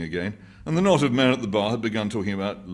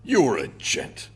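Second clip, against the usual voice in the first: put-on slow, higher, louder old man; gratified old man; 7 semitones higher, 6 dB louder, much slower than usual. A narrator's reading. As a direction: puff up at the compliment. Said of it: "said the other".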